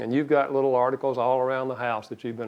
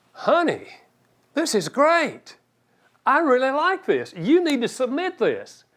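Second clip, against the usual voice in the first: high, bright-eyed praise of the article, slowed on this is great; delighted praise; 11 semitones higher than usual. Retold or imitated. Spouse reading the article, thrilled and encouraging. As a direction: gush with enthusiasm over the draft.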